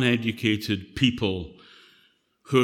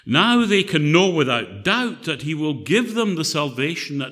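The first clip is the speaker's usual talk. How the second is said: louder, earnest Bible reading; scripture reading; 3 semitones higher, 3 dB louder than usual. Acted. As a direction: proclaim the passage to the congregation. Reads scripture aloud.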